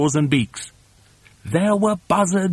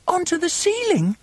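very high, incredulous squeak; high little monkey voice; small monkey's cry; 14 semitones higher than usual. A narrator's reading. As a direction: question it like a startled youngster. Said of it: "cried one of the small monkeys".

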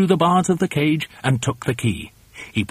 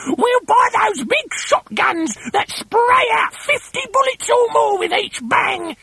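gleeful Missus Twit. Acted. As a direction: screech the wicked plan with relish.

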